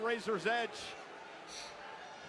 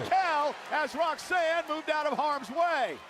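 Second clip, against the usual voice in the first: excited play-by-play; 5 semitones higher, 6 dB louder, slower than usual. Real, not acted. Live call of ringside spot.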